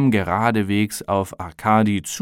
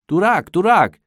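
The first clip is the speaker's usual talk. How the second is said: high, loud call across a pond; 9 semitones higher, 5 dB louder than usual. A narrator's reading. Arkadi calls his duck on the dark pond, calling out. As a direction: call the duck by name, pitched well up. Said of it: "rief Arkadi".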